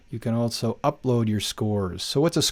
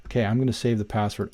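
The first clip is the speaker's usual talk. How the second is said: ordinary talk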